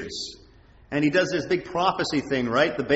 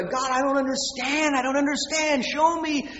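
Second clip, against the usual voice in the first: high-pitched anguished plea to God; acted-out desperate praying, voice lifted high; pleading prayer; 11 semitones higher than usual. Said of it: "fasting and weeping and praying".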